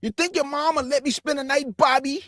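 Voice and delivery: high-pitched parody suburban buddy voice. Shown as a demonstration.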